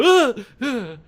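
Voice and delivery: high, loud mock scream of waking from a nightmare. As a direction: jolt awake with a hammed-up cry. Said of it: "I suddenly wake up and go".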